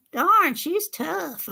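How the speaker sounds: slow, laughing puppet sigh; Minnie the puppet. Retold or imitated. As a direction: drag it out in the puppet voice. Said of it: "I had her to say".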